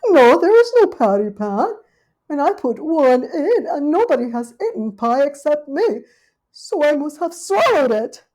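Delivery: high, loud dismayed lady dog; fretting Duchess. Acted. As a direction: wring your paws over it. Duchess reasons anxiously.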